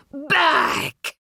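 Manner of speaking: high, forceful snarled command; sharp furious warning cry. A narrator's reading. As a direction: snarl it to drive them away. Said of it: "Yukio snarls".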